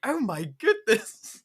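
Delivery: very high, slow and amazed; astonished mom. Retold or imitated. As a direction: draw it out in wide-eyed shock. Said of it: "she's like".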